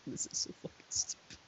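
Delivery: fast, hushed aside under the breath; real whispered aside. Real, not acted. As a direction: mutter it so only you hear. Aside while reading silly fanfic.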